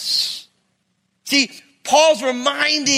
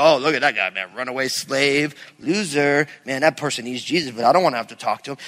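low, muttered-aside judgmental voice; mock disdain; 9 semitones lower than usual. Believer looking down on a runaway slave, mock disdain. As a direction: play a dismissive onlooker, pitched well down.

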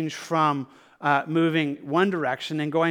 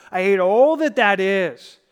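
emphatic declaration; 3 semitones higher, 8 dB louder than usual. Acted. Voicing hatred of sin.

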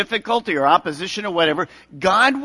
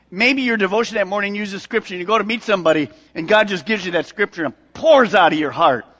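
normal talking voice

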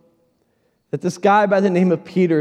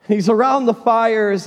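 ordinary talk, nothing marked

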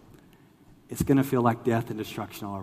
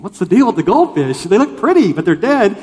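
high, loud, baffled objection; hammed-up bewilderment; mock protest; 7 semitones higher, 14 dB louder than usual. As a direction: retell a puzzled protest at full volume. Retold protest about the goldfish.

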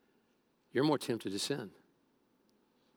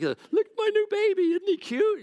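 very high, loud mock cooing; hammed-up baby-showing gush; gushing new parent; 5 dB louder than usual. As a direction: squeal it like a besotted parent showing off. Imitates proud parent showing baby.

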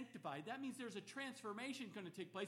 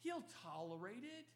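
usual speaking voice

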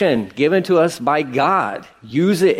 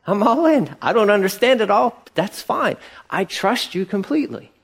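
higher, eager all-in voice; mock eager convert, pitched up; eager new believer; 7 semitones higher than usual. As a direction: gush like a brand-new believer. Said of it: "it was like".

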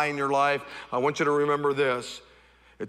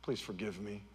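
contrite apology; 5 semitones lower, 11 dB quieter than usual. Retold or imitated. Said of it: "we may have to go back and say".

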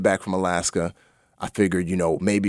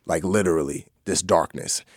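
ordinary talk

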